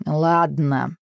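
glum, resigned agreement; a touch of gloomy surrender; deflated. A narrator's reading. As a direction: give in sourly. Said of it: "окончательно скисла и сказала".

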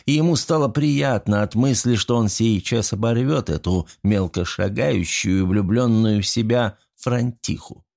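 ordinary delivery

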